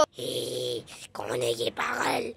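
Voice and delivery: low, quiet growling witch; deep witch voice. Acted. Witch snarling at the confused father, low growl. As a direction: menace him in a low growl.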